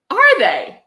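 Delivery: high, sarcastic "are they?"; mock surprise. Real, not acted. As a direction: feign shock, dripping sarcasm. Sarcastic reply to book's claim.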